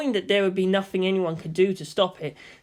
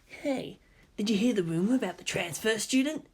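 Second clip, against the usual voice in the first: whispered; 3 semitones higher, 5 dB quieter than usual. A narrator's reading. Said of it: "leaned closer and whispered".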